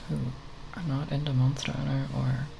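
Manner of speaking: ordinary delivery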